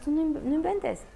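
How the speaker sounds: a touch of 'you're kidding'; lightly voiced amazement; mock disbelief. To the unsure mom, a mild mock 'no way'.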